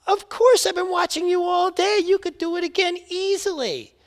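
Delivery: high, loud gushing enthusiasm; eager fan. Acted. Spectator cheering on the tightrope walker, high and gushing. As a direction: gush like a starstruck fan.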